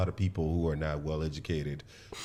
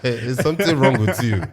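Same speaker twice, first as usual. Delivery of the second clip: put-on laughing through a higher, louder Nigerian accent; 6 semitones higher, 8 dB louder than usual. Still scolding those who judge him, in a laughing Nigerian accent. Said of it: "in my Nigerian accent".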